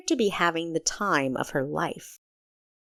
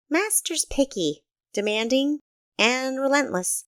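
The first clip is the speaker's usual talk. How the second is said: a higher, lightly voiced young boy, proud of his master; young Quinn listing his master's faults, half boasting; nine-year-old boy; 7 semitones higher than usual. Quinn tells Kessa about his strict master, a higher boyish voice.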